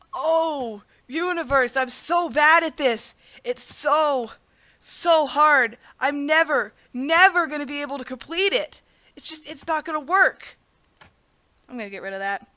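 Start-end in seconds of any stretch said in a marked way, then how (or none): 0.1-10.6 hammed-up wail of defeat, drawn out; feigned despair. Player faking out the universe with pretend despair. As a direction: plead helplessly, badly faking it for show. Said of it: "I'm gonna try to fake it out".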